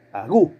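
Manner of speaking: "Agú" cortado tras la GU tónica; acento en GU. Shown as a demonstration. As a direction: remarca la segunda sílaba, a-GÚ. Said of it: "Gu es la sílaba tónica". (agú, con golpe en GU)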